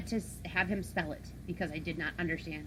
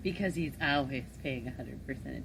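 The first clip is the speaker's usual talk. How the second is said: loud sarcastic jab at him; teasing sarcasm; 8 dB louder than usual. Real, not acted. Co-host mocking the host about not listening to his wife, loud sarcasm. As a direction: needle him loudly with mock praise.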